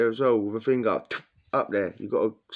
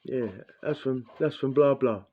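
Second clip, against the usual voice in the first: cleaner's voice; 4 semitones higher than usual. Retold or imitated.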